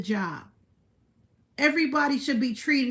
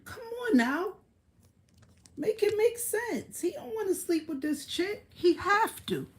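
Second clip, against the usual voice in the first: incredulous mock; 9 semitones higher, 4 dB quieter than usual. Real, not acted. Mocking a broke rapper's choices.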